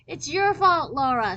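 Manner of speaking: loud, high accusing shout. Acted. Tiffany running past Laura, shouting blame. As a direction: yell the blame at her. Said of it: "she shouted as she ran".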